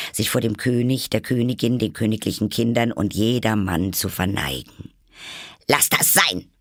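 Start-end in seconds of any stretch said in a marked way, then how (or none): 5.7-6.5 higher-pitched heated command; worked-up, irate. A narrator's reading. Queen stopping the gardeners' bowing, irate. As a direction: snap it, all worked up. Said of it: "ereiferte sich die Königin".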